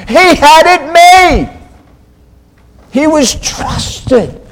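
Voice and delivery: high, loud, impassioned sermon delivery; full-force preaching. Real, not acted.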